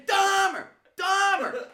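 high, loud holler; screaming heckle. Retold or imitated. Rival fan pointing and calling the nickname, screaming. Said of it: "he's screaming at me".

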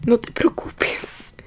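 very slow whisper with a laugh; whispered consoling. Retold or imitated. Taxi driver consoling the student at her house, slow whisper. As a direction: stretch it out in a breath of amused whisper.

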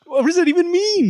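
very high, incredulous outburst; real exasperation. Real, not acted. Reader reacting to the FBI's hostages line, exasperated. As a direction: pitch it way up, utterly baffled.

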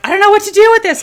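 high, loud mock panic; panicked quote. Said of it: "freaking out about it".

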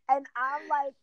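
usual speaking voice

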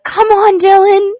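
put-on high, loud little kid calling out; toddler voice. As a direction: squeal it like a small boy. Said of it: "the little boy I was babysitting was like".

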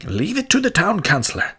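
high, fast knowing sneer; malicious. A narrator's reading. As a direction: say it with a sly, malicious air. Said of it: "with a malicious air".

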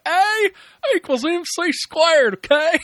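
high, slow mock Southern drawl on Einstein's formula. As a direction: play Einstein as a hammed-up Southern yokel. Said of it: "if Albert Einstein had a Southern accent".

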